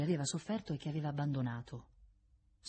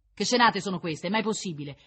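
loud, raised reprimand; stern scolding; 5 semitones higher, 7 dB louder than usual. A narrator's reading. Jailer stepping in on a quarrel, scolding. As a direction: break in indignantly, then ease off.

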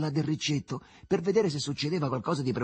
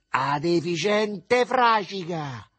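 loud, high, drawn-out insult; mother yelling; 6 semitones higher, 6 dB louder, much slower than usual. A narrator's reading. Mother at the stove insults her son, shouting. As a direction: yell it long and pitched up. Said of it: "gridò la madre davanti al fornello".

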